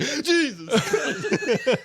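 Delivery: very high, very slow laughing exasperation; exasperated panda mom. Retold or imitated. Mama panda woken by her baby's sneeze, high slow exasperated groan. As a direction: drag it out like a fed-up mother. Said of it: "and the mom's like".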